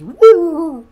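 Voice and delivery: loud, high drawn-out woo; high "woo" call. Acted. Streamer voicing Woolon Wolf's chat message, high drawn-out woo. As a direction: let out a long, high, loud whoop. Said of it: "Woolon Wolf says".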